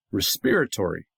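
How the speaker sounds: respiratory as res-PEER-a-tory; Canadian-style "respiratory", PEER stressed; Canadian pronunciation. Shown as a demonstration. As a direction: lean on the second syllable of "respiratory". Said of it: "the same thing in Canada".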